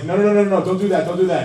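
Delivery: imitated director's frantic correction; alarmed director. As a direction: urgently stop the actor mid-take. Director stopping the shouting actor.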